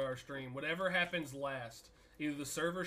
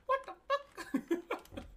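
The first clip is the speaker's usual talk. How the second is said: laughing disbelief; much slower than usual. Real, not acted.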